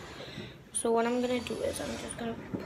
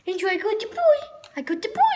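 high, louder taunt with a toy; excited pet talk; 10 semitones higher, 6 dB louder, faster than usual. Real, not acted. Girl shows her dog the ball, high excited teasing. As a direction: get the dog excited, pitch climbing.

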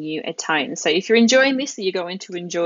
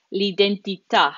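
l'identità with the last à stressed; final stress on l'identità. Shown as a demonstration. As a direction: land firmly on the final syllable of l'identità. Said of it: "this is where the emphasis goes".

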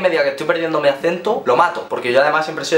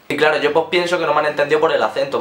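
ordinary talk

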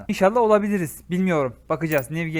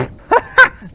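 squealing laugh, much higher and louder; high burst of laughter; 13 dB louder than usual. Real, not acted. Streamer laughs at own silly purchase, squealing. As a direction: laugh helplessly at yourself.

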